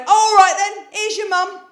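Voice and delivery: dad passing the buck; imitated dad. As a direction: hand the phone over quickly, done with it. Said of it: "he went".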